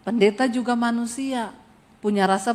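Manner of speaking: usual speaking voice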